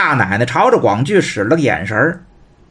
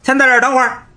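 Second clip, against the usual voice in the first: higher, sharp teasing brother; teasing younger brother; 7 semitones higher than usual. Acted. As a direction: stop the older brother with a mocking call. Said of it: "广兴瞧见了". (调侃的弟弟，声音偏高)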